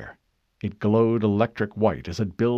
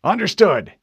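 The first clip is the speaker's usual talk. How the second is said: loud, higher, decisive acknowledgment; brisk officer's raised voice; commanding captain; 8 semitones higher, 7 dB louder than usual. Acted. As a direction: answer firmly over the bridge. Captain answers urgent report.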